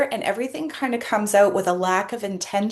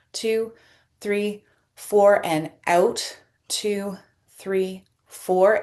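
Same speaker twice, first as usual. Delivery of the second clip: slow counting through the breath; paced breathing count; much slower than usual. Shown as a demonstration. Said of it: "So we're just gonna breathe".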